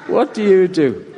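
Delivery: laughing through the words; laughing repeat. Retold or imitated. Preacher laughing while retelling the quip. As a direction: let the laugh spill into it.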